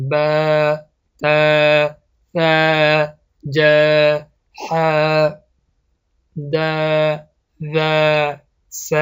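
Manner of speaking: each letter ب ت ث ج with a long aa, slowly; long letter sounds. Shown as a demonstration. Teacher reciting the alphabet drill. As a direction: draw each letter out with a long vowel, pausing between. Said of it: "مثال".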